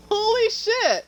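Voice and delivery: surprised. Real, not acted. Sudden sight in the game.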